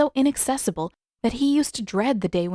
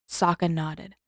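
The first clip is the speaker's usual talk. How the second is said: ordinary delivery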